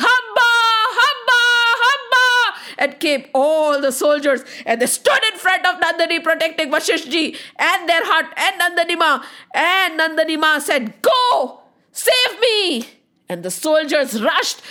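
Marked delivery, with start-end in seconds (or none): 0.1-2.3 high, loud cow's battle call; shouting. Acted. Cow keeps summoning her soldiers, shouting. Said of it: "and she kept shouting". 11.0-12.9 high, urgent call to arms; commanding cry. Acted. Cow sends her soldiers to save her, commanding cry.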